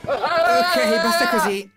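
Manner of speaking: high, loud shout. Real, not acted. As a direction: yell it out to stop them. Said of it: "non gridare".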